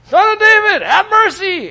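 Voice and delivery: high, loud beggar's call; crying out for mercy. Retold or imitated. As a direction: blind man calling out, pleading. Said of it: "crying out".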